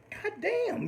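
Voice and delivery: high, startled cry of disbelief; shocked exclamation. Retold or imitated. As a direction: react in shock to the dog's snap. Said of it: "we're like".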